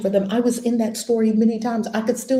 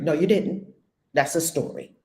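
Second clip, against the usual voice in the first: ordinary talk, nothing marked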